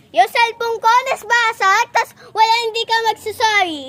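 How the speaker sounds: loud, heated demand for an apology; angry demand. Acted. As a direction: scold them for not apologizing. (galit na paghingi ng sorry)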